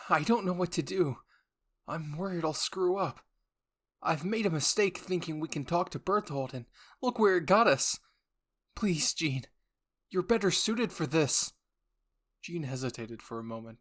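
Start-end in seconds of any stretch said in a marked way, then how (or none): 0.1-11.5 high, anxious and pleading, louder; a scared boy begging someone else to lead; nervous Armin voice, higher and louder; worried pleading. Acted. Armin panicking, pleading with Jean.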